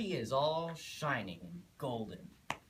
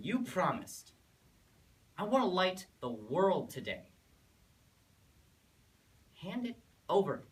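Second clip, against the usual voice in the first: put-on loud, raised-pitch teenage pleading; insistent demand; 6 semitones higher, 5 dB louder than usual. Son demanding the keys from his father, loud and insistent. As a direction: push harder with each claim, voice high and loud.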